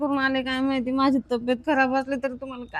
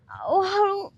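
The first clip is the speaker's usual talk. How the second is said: pained cry; 7 semitones higher, much slower than usual. Acted. Wife in pain, crying out.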